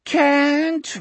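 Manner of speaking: high, pitiful moan; high-pitched moaned refusal. Acted. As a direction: moan it in a troubled sleep. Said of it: "Richard moaned".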